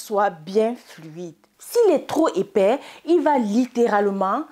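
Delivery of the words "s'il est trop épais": normal talking voice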